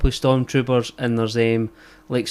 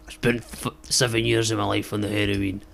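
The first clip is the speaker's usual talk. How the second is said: put-on lower, heavy Scottish accent of a hard-living local; thick Scottish accent; 3 semitones lower than usual. Ordering Iron Brew in an English McDonald's, thick Scottish accent. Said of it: "I'll do it in the most Scottish way that I can".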